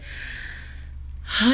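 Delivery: long breathy exasperated sigh; heavy sigh. Real, not acted. Host reading comments lets out a heavy sigh. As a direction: let out a weary sigh before reading on.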